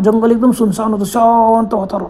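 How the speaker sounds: ordinary delivery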